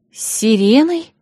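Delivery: high, slow, rising echo question; uneasy question. A narrator's reading. Bride echoes Yasha's mention of a siren, uneasy surprise. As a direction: repeat it in frowning disbelief. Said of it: "нахмурилась я".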